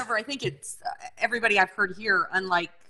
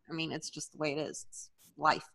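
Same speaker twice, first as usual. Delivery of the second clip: usual speaking voice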